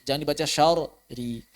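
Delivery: normal talking voice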